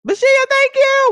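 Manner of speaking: very high, loud thanks; excited shout-out. Real, not acted. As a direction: cry out thanks to a gifter. Shouting out a gift.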